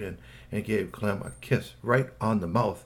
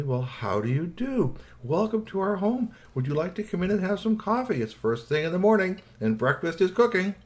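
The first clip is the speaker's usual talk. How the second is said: higher-pitched, courteous hostess welcome; very polite; 8 semitones higher than usual. A narrator's reading. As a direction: invite the guest in with careful politeness. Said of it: "said very politely".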